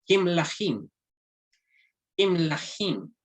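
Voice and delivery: Kim Lahim with i in the first syllable; model pronunciation. Shown as a demonstration. Teacher models the reading of the kings word: Kim Lahim. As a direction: say Kim Lahim twice, clearly for repeating. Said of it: "vamos a pronunciar".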